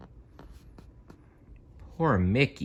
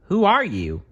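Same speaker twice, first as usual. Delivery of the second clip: high, louder surprised call; startled question; 11 semitones higher, 4 dB louder than usual. Acted. Farmers calling to the voice in the box. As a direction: ask the hidden voice in alarm.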